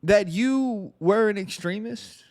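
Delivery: sarcastic, high-pitched disbelief. Pretend. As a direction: feign shock at this revelation. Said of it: "I'm being sarcastic".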